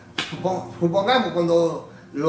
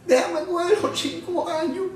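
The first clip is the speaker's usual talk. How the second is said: tearful pleading; 9 semitones higher than usual. Retold or imitated. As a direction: plead with the Lord, voice up high and close to tears. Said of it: "Y empezó a llorar".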